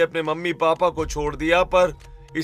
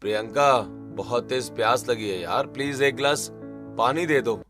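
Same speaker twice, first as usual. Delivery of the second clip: tired; 5 semitones lower than usual. Acted. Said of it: "थका हुआ office से अपने घर आता है".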